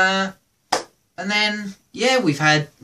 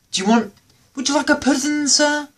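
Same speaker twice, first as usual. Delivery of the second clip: polite waiter; 9 semitones higher than usual. Retold or imitated. Waiter offering pudding.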